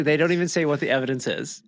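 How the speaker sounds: ordinary talk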